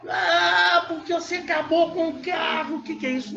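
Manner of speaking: angry outburst. Acted. Husband scolding wife for crashing the car, mock angry. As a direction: blow up over the wrecked car, hammed-up. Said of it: "Não, não é assim que faz".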